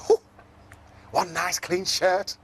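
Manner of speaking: slow, high cheerful zebra counting shirts; Zippity voice. Acted. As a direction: count the laundry brightly, taking your time. Zippity counting his washing.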